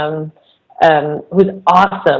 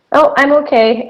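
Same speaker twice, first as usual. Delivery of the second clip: lightly imitated child, pitched up; little girl; 7 semitones higher than usual. Girl in the stall telling counselor she's fine, imitated child. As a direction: raise your voice like a child brushing off help. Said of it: "she's in the stall by herself".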